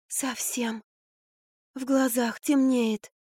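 feeble babble. A narrator's reading. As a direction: drag it out as if about to faint. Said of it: "лепетала Марфа". (слабый, протяжный лепет)

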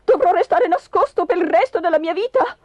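high, distressed exclamation; anguished high-pitched question; dismayed husband. Acted. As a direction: protest your awful fate. Husband dismayed at his fate.

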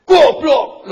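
screamed insult at full force; angry shout. Shown as a demonstration. Preacher shouting an angry insult at a child. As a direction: yell it as if across a gulf. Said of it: "orang marah itu ... pasti teriak".

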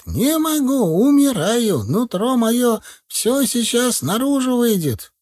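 high, drawn-out lament; wailing old man. A narrator's reading. Дед причитает, умоляя снять горшок, тягучим стоном. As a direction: wail like a suffering old man. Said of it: "запричитал дед".

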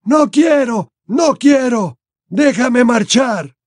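high-pitched, pleading wail; frightened old man whimpering; moaning. A narrator's reading. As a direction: beg desperately to be let go. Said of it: "gimió de nuevo".

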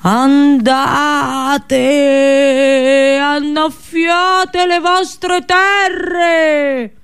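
high, drawn-out oracular command; oracle's voice. Retold or imitated. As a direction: sing the order out like a god through its priestess. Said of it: "cercando il tuo stesso tono".

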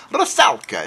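a second go at Rusalka that drifts toward Italian; Italian-sounding accent. Shown as a demonstration. Retrying the title and landing on an Italian accent. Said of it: "It sounded Italian".

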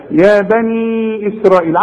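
يا بني إسرائيل with the long vowels stretched. Shown as a demonstration. Teacher demonstrates the madd to his student. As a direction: hold the madd in إسرائيل for four counts. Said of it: "عندك المد ده".

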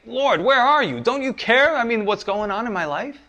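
high, anguished plea to the Lord; desperate prayer. Retold or imitated. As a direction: cry out to God as if drowning. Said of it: "and you say".

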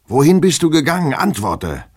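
loud, very high shouted question; exasperated demand. Acted. Master demanding where his servant went, exasperated shouting. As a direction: fire the question, then order an answer.

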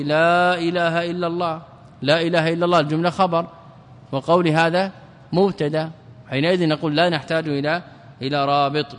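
ordinary talk, nothing marked

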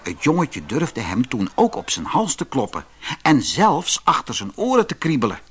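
ordinary delivery, nothing marked

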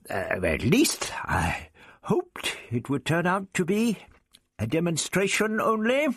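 put-on relieved old scholar musing aloud; gently voiced elderly Doctor, relieved; lightly voiced old Doctor.

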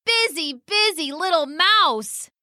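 high, teasing bird voice; sing-song mocking call up high; teasing pheasant. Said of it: "called the pheasant".

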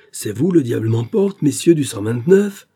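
put-on disbelieving recognition of old foes; a touch of amazed bluster; surprised. A narrator's reading. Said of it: "avec l'accent de la surprise".

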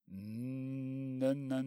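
long, droned stammer of malice; spiteful. A narrator's reading. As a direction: spitefully stretch out the stuttered syllable. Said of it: "заговорил Персиков злобно".

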